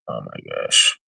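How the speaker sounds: very low, weary groan; exasperated low mumble of disbelief; dismayed. Real, not acted. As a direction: sink right down in pitch after a sigh. Sighing in dismay at the new title.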